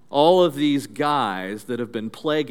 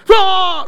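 high, explosive kiai shout; sustained loud fighting yell; karate attack cry; 10 dB louder than usual. Acted. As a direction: yell it like a martial arts strike. Acting out karate attack cries.

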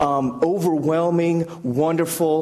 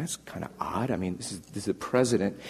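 much softer, puzzled under the breath; puzzled mutter; 6 semitones lower, 9 dB quieter than usual. Retold or imitated. Colleague muttering to himself at the odd lettuce. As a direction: mutter it, baffled.

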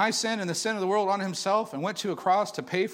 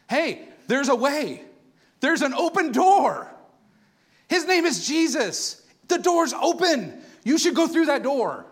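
slow, louder, higher proclamation; preaching; 6 semitones higher, 5 dB louder, slower than usual. Real, not acted. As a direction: proclaim each phrase slowly. Preaching climax, slow and loud.

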